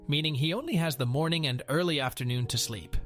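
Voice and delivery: usual speaking voice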